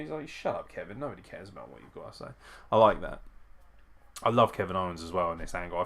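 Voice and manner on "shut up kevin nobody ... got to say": soft, low brush-off; Roman Reigns's dismissiveness. Retold or imitated. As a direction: imitate offhand contempt, quick and quiet.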